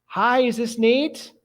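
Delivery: a kind office lady asks slowly who's calling; sweet lady on the phone. Retold or imitated. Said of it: "I hear this voice say".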